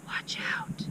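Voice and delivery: hushed urgent warning in a whisper. A narrator's reading. Horatius warns friends on the playground, whispered. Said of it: "Horatius whispered".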